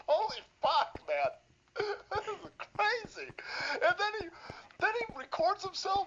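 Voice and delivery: can't stop laughing at it; laughing disbelief. Real, not acted. Laughing at the clip.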